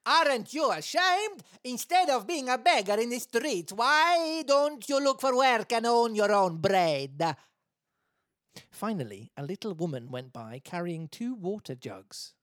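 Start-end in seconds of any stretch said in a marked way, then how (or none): 0.1-7.4 high, loud angry Italian crowd. Acted. Twenty passers-by shaming the beggar, angry Italian voice. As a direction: scold him in hammed-up Italian. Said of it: "it was just angry Italian".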